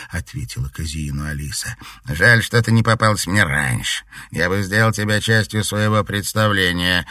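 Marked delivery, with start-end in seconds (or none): 2.1-7.1 cold statement. A narrator's reading. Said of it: "холодно заявил Альбинос".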